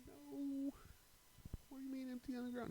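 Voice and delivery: very high, loud squeal of disbelief; exaggerated high whine at an order; mock dismayed protest. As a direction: protest in a comic high-pitched voice. Retold protest at cop's order.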